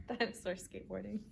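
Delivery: usual speaking voice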